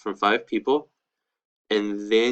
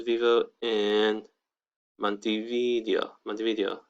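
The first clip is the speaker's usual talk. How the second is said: slow, careful Spanish model; slow model phrase. Shown as a demonstration. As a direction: stretch out each word for learners. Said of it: "And another one".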